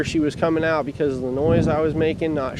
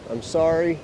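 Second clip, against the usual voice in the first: usual speaking voice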